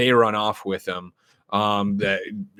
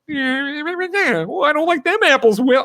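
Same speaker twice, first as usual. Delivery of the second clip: very high, louder caricature voice; weird Ben Affleck; 4 dB louder, slower than usual. Retold or imitated. Podcaster mocking Affleck chewing the scenery, weird high voice. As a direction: ham up a weird, high-pitched character. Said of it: "being this weird".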